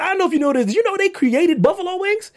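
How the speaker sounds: high-pitched, eager questioning; excited discovery. Real, not acted. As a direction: pitch it up, bursting to share a revelation. Eagerly sharing a buffalo wings discovery.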